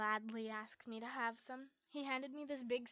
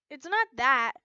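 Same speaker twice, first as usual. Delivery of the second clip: loud protest; 2 semitones higher, 17 dB louder than usual. Acted. Arguing about diving.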